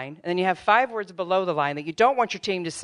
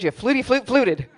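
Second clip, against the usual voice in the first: higher, giggling teasing rhyme; playful rhyming retort; laughing comeback; 7 semitones higher, 4 dB louder than usual. Retold or imitated. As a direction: tease through a laugh.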